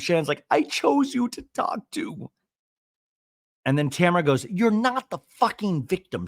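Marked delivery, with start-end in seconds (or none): 0.5-2.3 high, slow mock wail; hammed-up hurt protest; wounded reproach. Acting out Shannon's hurt reproach. 4.5-6.3 higher-pitched imitated scolding; hammed-up accusation; mock angry retort. Acting out Tamara's angry retort.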